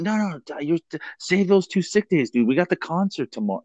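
mock-urgent, high-pitched pleading; pleading friend. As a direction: imitate a friend talking someone out of it. Acting out friends urging him.

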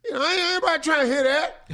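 high, fast, loud brush-off; indignant neighbor. Acted. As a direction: shut the complaint down loudly. Acting loud neighbor rejecting complaint.

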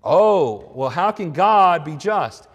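loud, drawn-out mock objection. Mockers challenge whether God is just, mocking. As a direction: scoff it slowly, with a big loud "Oh". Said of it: "people who are mocking this".

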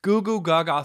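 high mock baby babble; a hint of toddler gurgle; baby talk. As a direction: coo it like an infant. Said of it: "sounding like a toddler".

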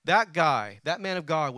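normal talking voice

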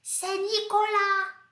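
high, slow tattletale voice; tattling kid. Retold or imitated. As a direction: point the finger like a sneaky pupil. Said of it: "o Ayan falou assim". (voz aguda e lenta de criança dedo-duro)

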